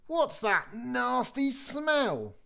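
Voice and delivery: very high, slow, disgusted; little girl voice. Acted.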